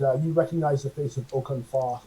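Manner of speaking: normal talking voice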